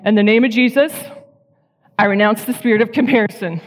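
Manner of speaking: retold declaration. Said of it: "I'm like".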